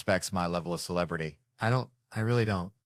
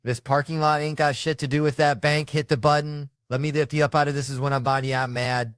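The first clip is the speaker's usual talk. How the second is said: higher, louder mock snap; snapping; 6 semitones higher, 4 dB louder than usual. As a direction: bark it back, fed up. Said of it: "I snapped".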